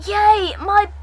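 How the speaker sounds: high, squeezed mock cheer; grunted. A narrator's reading. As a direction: cheer while being crushed in a hug. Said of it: "I grunt".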